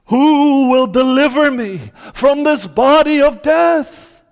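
a touch of a wail; anguished cry. Acted. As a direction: cry out for rescue. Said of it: "he cries out".

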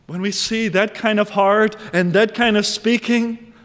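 ordinary talk, nothing marked

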